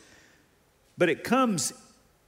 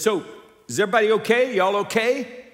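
upbeat check-in; 5 semitones higher, 5 dB louder, faster than usual. Retold or imitated. Retold check-in with teenagers on Zoom.

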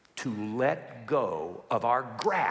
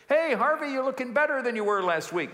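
higher, bright-toned pastor greeting; lightly voiced hospital visit; cheery pastor; 9 semitones higher than usual. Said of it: "the pastor ... sat down with him".

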